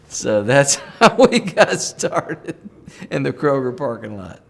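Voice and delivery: slow and laughing through the words; fond laughing memory. Real, not acted. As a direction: let the laugh break into it, slowly. Laughing while recalling the first kiss.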